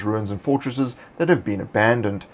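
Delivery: ordinary talk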